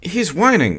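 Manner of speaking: the wife frets about the whining dog, nervously. A narrator's reading. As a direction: let the worry creep in. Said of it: "she continued nervously".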